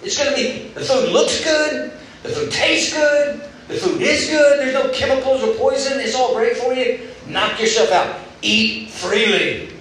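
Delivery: high, bright-eyed, lavish invitation with a laugh; a thrilled host waving at a buffet; generous God, excited. Retold or imitated. Preacher voicing God telling Adam to feast, excited. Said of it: "And he said".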